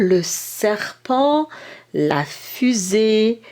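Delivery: "serpent" and "fusée" said slowly, s and f hissed long; slow teacher demo of long consonants; stretched consonants. Shown as a demonstration. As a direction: draw out the s and the f so the child hears them. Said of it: "les consonnes longues, celles qui durent en bouche".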